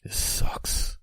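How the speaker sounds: slow, whispered, deflated; defeated whisper. Acted. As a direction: whisper it like you've given up.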